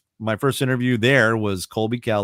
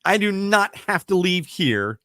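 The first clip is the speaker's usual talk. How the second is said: retold rant; 8 semitones higher than usual. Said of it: "I'm like".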